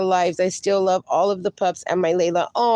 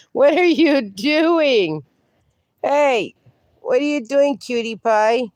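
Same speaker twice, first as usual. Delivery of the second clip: higher, slow, drawn-out dog-talk voice; pet talk; 3 semitones higher, slower than usual. Real, not acted. Owner asking her puppy what it's up to, slow coo. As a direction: stretch it out, cooing at a puppy.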